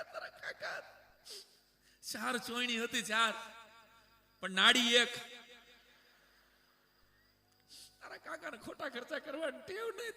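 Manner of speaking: mock weeping. Widowed aunt praising her late husband's habits, mock weeping. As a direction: wail like a bereaved aunt, pitch rising sharply. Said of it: "રોતા રોતા કાકીએ કીધું".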